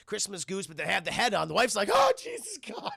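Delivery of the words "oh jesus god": very high startled shriek; shocked wife. Retold or imitated. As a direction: shriek at a horrible sight. Wife shocked by goose head.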